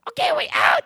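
high squealing leprechaun shout. Acted. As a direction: shriek it like a giddy leprechaun. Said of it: "A Mexican leprechaun?".

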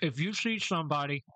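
talking around a mouthful of ice; words muffled by crunching ice; chewing ice. Real, not acted. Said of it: "sorry, chewing on ice".

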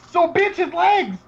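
a fan's indignant rant; outraged rant. Real, not acted. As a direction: hammer home the absurdity.

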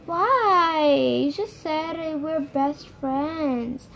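drawn-out, slow mimicked complaint; whiny mocking tone. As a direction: imitate a friend's wheedling protest, stretching the words. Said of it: "She had this tone".

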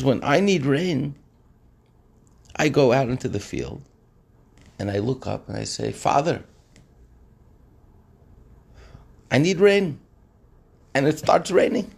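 high, insistent retold voice; simple man's conviction. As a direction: raise it high, slowing on the plea.

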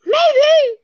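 high-pitched playful echo; squeaky maybe echo. Retold or imitated. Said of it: "or how about we go".

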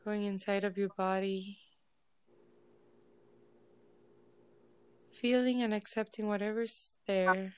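unhurried, measured relaxation voice with lingering silences; guided meditation. Real, not acted. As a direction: ease listeners into stillness, phrase by phrase. Leads a group meditation.

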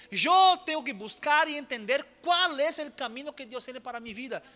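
loud, rising sermon delivery; preaching. Acted. Preacher urging the congregation to follow their own calling, full-force preaching. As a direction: press the point home to the congregation.

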